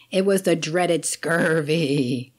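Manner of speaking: slow, mock pirate growl. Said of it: "did I sound like a pirate".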